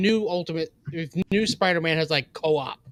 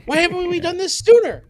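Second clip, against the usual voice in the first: mock company exec; 13 semitones higher, 7 dB louder than usual. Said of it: "you're like".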